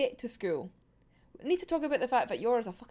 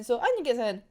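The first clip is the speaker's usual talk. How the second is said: ordinary talk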